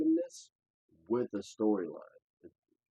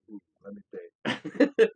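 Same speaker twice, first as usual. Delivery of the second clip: imitating Griffin; 7 dB louder, much slower than usual. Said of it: "Griffin was like".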